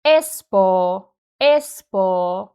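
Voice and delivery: "Espoo" with ES stressed and a held "oo" as long o; front-stressed "ES-pohh"; long unstressed O. Shown as a demonstration. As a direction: stretch the final o of "Espoo". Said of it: "long o".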